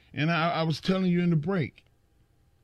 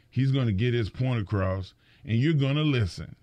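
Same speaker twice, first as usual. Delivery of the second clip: ordinary delivery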